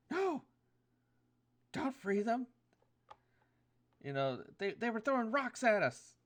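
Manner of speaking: high, loud pixie cry of alarm; pixies calling out. Acted. Pixies shout to the party not to free goats, high and loud. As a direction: shout a shrill warning as tiny pixies. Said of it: "three little pixies kinda call out".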